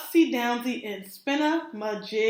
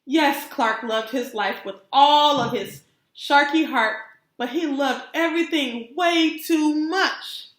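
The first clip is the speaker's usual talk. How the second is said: ordinary delivery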